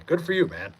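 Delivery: ordinary talk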